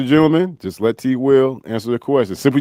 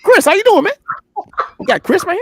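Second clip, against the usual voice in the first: much higher, hammed-up coach greeting; Coach Raheem impression. Impersonating the coach greeting T Will, high and hammy. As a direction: greet the player like a cheery mock coach. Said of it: "what would Coach Raheem of the Atlanta Falcons have to say".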